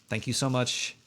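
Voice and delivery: ordinary talk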